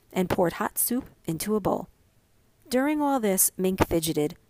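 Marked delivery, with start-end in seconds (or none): none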